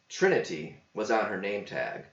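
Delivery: usual speaking voice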